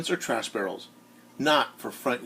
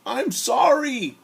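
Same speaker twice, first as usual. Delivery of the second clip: hollered apology; 12 semitones higher, 6 dB louder, slower than usual. A narrator's reading. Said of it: "until he hollered".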